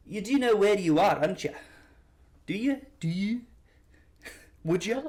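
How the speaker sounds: put-on loud, overdone Scottish accent; earnest but overcooked Scottish attempt. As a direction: lilt upward in a hammed-up Scottish accent. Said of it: "I'll try".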